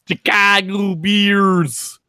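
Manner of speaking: put-on slow, drawn-out Chicago accent. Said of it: "Did I do it?".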